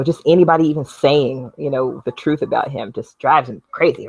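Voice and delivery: normal talking voice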